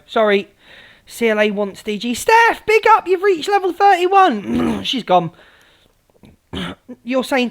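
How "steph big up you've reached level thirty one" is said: excited shout-out. Real, not acted. Shouting out gifter on live.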